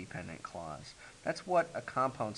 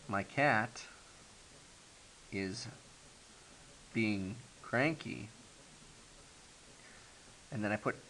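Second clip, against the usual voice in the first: slow, clause by clause; slower than usual. Shown as a demonstration. Teacher building a compound sentence for students, slow and pausing. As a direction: read slowly with long pauses between words. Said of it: "combine this by saying".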